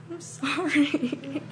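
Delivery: high, soft, slow whimper; mock-tearful apology. Traveller confessing to customs officer, mock-tearful. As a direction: whimper it as if about to cry. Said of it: "And I was just like".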